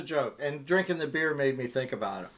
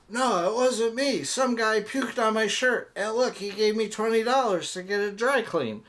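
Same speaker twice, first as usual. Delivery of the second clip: put-on protesting drunk husband; 7 semitones higher than usual. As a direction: plead innocence like a tipsy husband in a joke. Drunk husband's excuse acted in joke.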